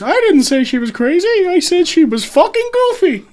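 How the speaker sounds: goofy cartoon voice. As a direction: deliver the punchline as a cartoon dog. Joke punchline, character voice.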